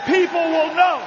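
fiery campaign rally cry; rally speech at full force. Acted. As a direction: preach it to the crowd, building. Rousing rally crowd.

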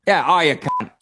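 Australian slang insult in a put-on accent; attempted Australian accent. Shown as a demonstration. Actor showing off picked-up Aussie slang, attempted Australian accent. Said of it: "whatever Australian accent you can muster up".

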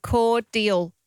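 cordial in careful syllables, "cord-ial"; model pronunciation. Shown as a demonstration. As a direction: teach cordial slowly with "cor" up front. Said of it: "after me".

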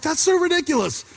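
high, incredulous scoff; high, hammed-up ridiculing remark; scoffing listeners. Listeners react to story.

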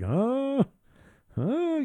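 high, questioning huh; mock-suspicious grunt.

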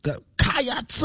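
"Cats" drawn out as "kai-ats", two syllables; Go Cats with a stretched vowel. Retold or imitated. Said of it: "Not exactly".

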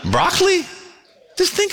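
high incredulous squeak; mock high "Broccoli?". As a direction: squeak it in disbelief. Mocking absurd creation claim.

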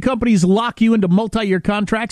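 upbeat sponsor read. Acted. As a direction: sell it like a radio ad. Reads sponsor ad.

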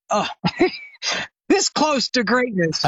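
laughing through the words at his own bad luck; rueful laugh. Real, not acted. Telling the host he nearly got famous, laughing. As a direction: chuckle it out, amused and a bit rueful.

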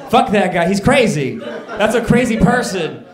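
loud, exasperated outburst; mock indignant rant. Real, not acted. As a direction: vent at him like he wronged you. Ranting about the homeless guy.

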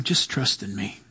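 very low, soft murmur; hushed, deep-pitched reassurance; still small voice. Acted. God heard once the yelling tires, still small voice. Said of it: "whispering still small voices to you".

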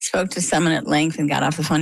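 usual speaking voice

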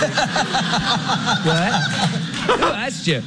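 laughing high through the retort; can barely get it out for laughing; giggling disbelief, voice up high. Real, not acted. Laughing retelling, incredulous.

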